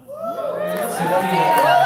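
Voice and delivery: calling out. Real, not acted. Host calls performer to stage.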